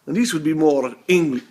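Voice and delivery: English accent slipping into Transylvanian; botched English accent. Shown as a demonstration. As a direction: attempt English, land near Transylvania. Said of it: "that's not even English. That's like Transylvania".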